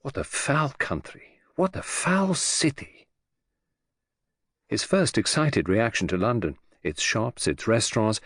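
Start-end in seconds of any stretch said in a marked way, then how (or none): 0.0-3.0 revulsion. A narrator's reading. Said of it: "thought with revulsion".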